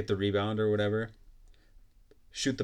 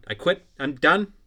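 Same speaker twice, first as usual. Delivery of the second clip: higher, louder hammed-up yell; mock rage quit; 6 semitones higher, 8 dB louder than usual. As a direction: replay a kid's rage quit, shouting. Reenacting own rage quit.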